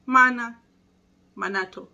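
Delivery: ordinary talk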